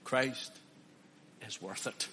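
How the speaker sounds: hushed. Real, not acted. Hushed after climax.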